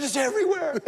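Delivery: high, loud and thrilled; imitating an excited wife, high and loud. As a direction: insist it's everywhere, delighted. Wife excitedly telling him news.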